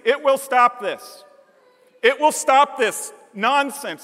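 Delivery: fervent preacher driving the point home; preaching at full force. Acted. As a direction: preach it like a rising revival call.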